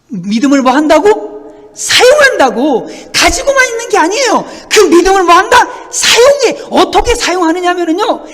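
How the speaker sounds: high, loud question-and-answer preaching; emphatic preaching. Real, not acted. Preacher pressing the congregation on using faith, emphatic. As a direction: hammer the point home with rising pitch.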